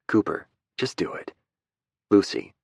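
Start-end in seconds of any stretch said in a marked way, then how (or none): 0.8-1.3 urgent hushed whisper; whispered command. Acted. Sneaking her out past brother.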